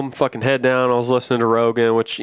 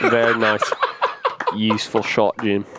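golf commentator voice; 3 semitones lower than usual. Retold or imitated. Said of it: "my golf voice".

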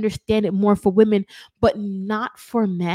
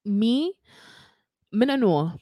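Jamaican accent; 3 semitones higher than usual. Real, not acted. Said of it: "let me not speak in Jamaican".

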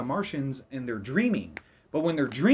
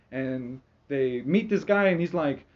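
ordinary delivery

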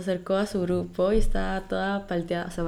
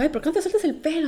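friend's teasing voice; 8 semitones higher than usual. Retold or imitated. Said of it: "Y dije".

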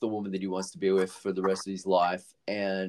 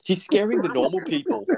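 louder, higher complaint; mock indignant guard; 10 semitones higher, 6 dB louder than usual. Guard telling others to remove him, mock indignant. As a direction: raise it like a fed-up bouncer.

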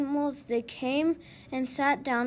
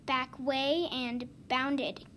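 ordinary delivery, nothing marked

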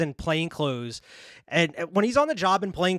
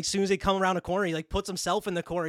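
ordinary talk

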